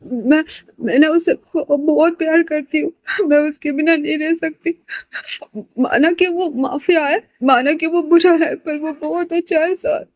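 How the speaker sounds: crying. Acted. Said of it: "Sanaya ना रोते-रोते कहती है". (ऊँची, धीमी, रोती हुई आवाज़)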